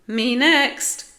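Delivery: high, loud bid for a turn; keen, boastful shout; eager cry. A narrator's reading. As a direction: cry out to be picked. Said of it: "cried Spike".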